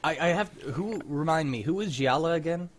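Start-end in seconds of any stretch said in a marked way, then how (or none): none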